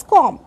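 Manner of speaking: "comb" with the final B left silent. Shown as a demonstration. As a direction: say "comb" ending on M, no B. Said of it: "b is silent".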